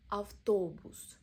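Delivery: "Автобус" said slowly and loudly, the middle O long and stressed; "Автобус", leaning hard on the О; slow, loud stress demo. Shown as a demonstration. Teacher slowly showing learners the stressed middle O. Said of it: "Middle O is stressed".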